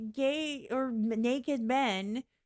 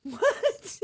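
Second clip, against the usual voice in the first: laughing disbelief; 9 semitones higher, 6 dB louder than usual. Real, not acted.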